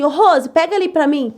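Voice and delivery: mais alto e mais agudo, firme na cozinha; chamado alto de chefe. Retold or imitated. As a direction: chamar a ajudante em tom de chefe. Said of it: "por que você tá gritando? Por que você tá falando bravo?". (grito mandão de chefe na cozinha)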